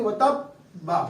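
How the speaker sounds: وَتَبَّا slow, the ب held and doubled; sit on the ب then say وَتَبَّا; correct slow reading. Shown as a demonstration. Teacher demonstrating the right doubled ب for students. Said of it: "سکون کریں گے".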